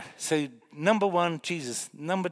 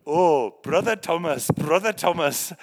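slow, louder, reverent naming of the saint; imitating an Indian pastor; 3 semitones higher, 10 dB louder than usual. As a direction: ham up the pastor's solemn reply. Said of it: "They go".

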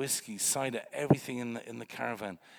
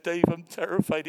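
retold terror; 5 semitones higher, 9 dB louder than usual. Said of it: "he said".

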